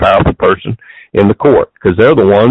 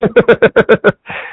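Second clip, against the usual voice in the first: laugh; 9 semitones higher than usual. Real, not acted. Laughing at the fireman's fear.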